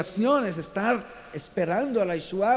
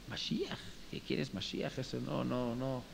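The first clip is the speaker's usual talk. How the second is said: a puzzled, whispered question; the farmer has never heard the word; breathless, baffled echo of Mashiach; 4 semitones lower, 9 dB quieter than usual. Retold or imitated.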